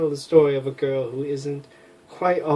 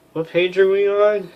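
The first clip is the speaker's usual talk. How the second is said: ordinary delivery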